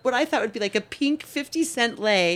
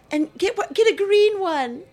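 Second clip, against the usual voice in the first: high, loud call down from upstairs; yelled from balcony; 11 semitones higher, 4 dB louder than usual. Retold or imitated. Daughter yelling from the balcony to mom at the door, high and loud. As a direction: shout the order over the balcony. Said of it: "she yells out over the balcony".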